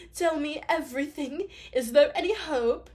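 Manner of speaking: anxious posh princess pleading. Acted. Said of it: "She's like the posh voice".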